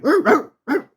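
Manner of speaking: high, short imitated barks; quick high "rr" barking; dog bark imitation. Shown as a demonstration.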